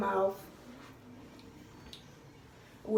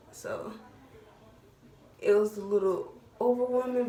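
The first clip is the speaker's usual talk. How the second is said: usual speaking voice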